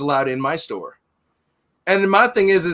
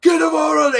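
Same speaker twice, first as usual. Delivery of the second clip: very high mock scream; retold screaming; 12 semitones higher, 4 dB louder, faster than usual. Neighbor losing his mind at the manager, mimicked scream. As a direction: imitate someone losing it mid-argument. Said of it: "starts screaming at me".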